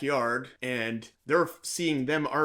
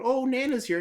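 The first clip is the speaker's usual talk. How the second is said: put-on kids calling out; 12 semitones higher, 4 dB louder than usual.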